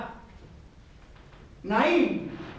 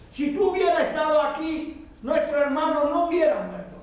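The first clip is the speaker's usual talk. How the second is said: higher, reproachful plea; grieving sister; 5 semitones higher than usual. Retold or imitated. Marta reproaches Jesus over her dead brother, higher and pleading. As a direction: lift the voice, a sister's hurt reproach.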